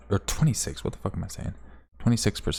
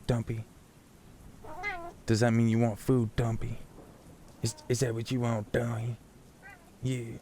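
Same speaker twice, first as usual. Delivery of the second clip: soft, slightly raised pet-talk voice; talking to the cat; 3 semitones higher, 8 dB quieter than usual. Real, not acted. Streamer asking the meowing cat about food, soft pet talk. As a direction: coo quietly to a hungry pet.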